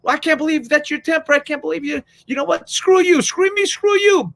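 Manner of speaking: a spouse fight building to shouting; mock marital fight. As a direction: bicker like a couple blowing up. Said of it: "your wife or your husband says".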